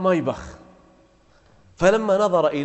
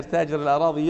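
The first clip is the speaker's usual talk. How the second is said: ordinary talk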